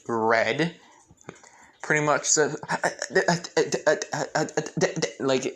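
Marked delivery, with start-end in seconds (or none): 2.7-5.1 flustered stammer on broken syllables; stutter, unable to get the word out. Acted. Hinata just asked on a date, stuttering. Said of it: "like, you know, stuttering".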